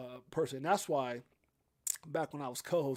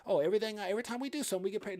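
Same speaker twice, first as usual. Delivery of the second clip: much higher, mocking voice of a cash-chasing channel; mocking sing-song; 7 semitones higher, faster than usual. Vlogger mocks channels that only chase money, high mocking voice. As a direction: sneer it up high like a greedy streamer.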